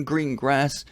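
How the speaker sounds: ordinary talk, nothing marked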